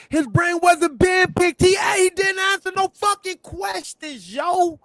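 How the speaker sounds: high, loud, heated outburst; shouting down the other guest; angry shout. Real, not acted. Heated argument, he erupts.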